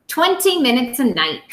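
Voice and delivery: put-on lively storytime voice for young kids; reading a picture book aloud. Teacher reading to her second graders, lively storytime. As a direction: read it out to the class with bounce.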